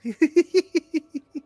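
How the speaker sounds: put-on high, loud eerie child giggle; ghostly giggle. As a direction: burst into a creepy giggle. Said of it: "in your head giggle just bursts out".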